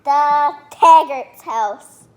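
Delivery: loud proud announcement. Real, not acted. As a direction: proclaim it like a headline. Kid proudly announcing real recording.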